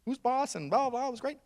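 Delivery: high, gushing praise; mock-starstruck congratulation; impressed onlooker. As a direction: gush in an awed high voice. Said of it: "the guy's just like".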